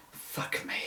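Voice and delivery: stunned, breathless whisper; whispered oath. Acted. Policeman hearing the radio news in a stunned whisper. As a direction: breathe it out in disbelief.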